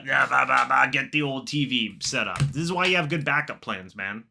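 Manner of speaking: normal talking voice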